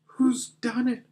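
high-pitched, dismayed demand; distressed cry. Acted. Susan at the broken table, acted distressed cry. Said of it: "cried Susan".

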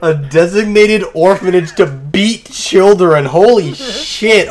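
slow, stunned repeat; amazed disbelief. Real, not acted. Reacting to a partner's absurd answer, amazed.

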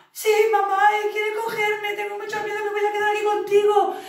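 put-on high, loud frightened kid voice; scared child calling. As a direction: cry out for mum in a panic.

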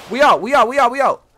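loud, punchy repeated call; urgent getaway call. Pretend. Player calling crew to leave the scene, urgent shout. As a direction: shout the retreat to everyone.

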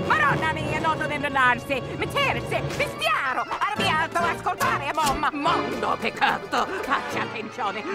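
loud, fast scolding in Italian; angry Italian tirade. Acted. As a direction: rant like an outraged Italian mother.

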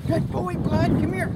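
high, coaxing call to the horse. Real, not acted. Owner calls her horse over, high and coaxing. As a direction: coax the horse over sweetly.

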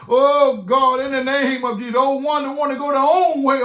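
ordinary talk, nothing marked

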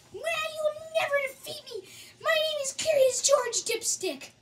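sneering panto villain. Acted. Villain boasts again.

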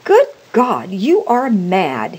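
loud, slowing cry of horror; aghast. A narrator's reading. Husband to his frantic wife, loud and aghast. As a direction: cry out, appalled. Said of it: "he cried aghast".

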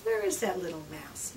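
ordinary delivery

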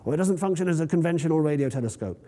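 usual speaking voice

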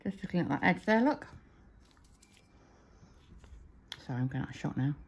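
slight lisp from a brush in the mouth. Real, not acted. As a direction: talk around a brush held in your teeth. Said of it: "I'm talking like I've got a lisp".

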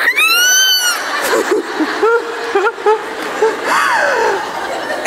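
long, high squealing laugh; inconceivable laughter. Retold or imitated. Wife hearing they should have a baby, squealing laughter. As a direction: burst into shrieking, disbelieving laughter. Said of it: "that inconceivable laughter".

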